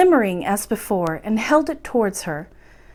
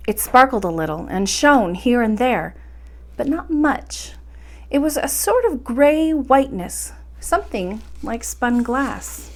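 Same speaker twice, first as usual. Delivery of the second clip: ordinary delivery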